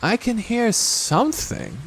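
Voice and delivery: put-on high, slow boy's voice; Christopher Robin. A narrator's reading. Boy listens near the trap, slow high voice. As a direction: draw it out in a child's pitch. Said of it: "said Christopher Robin".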